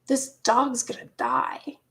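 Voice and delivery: ordinary delivery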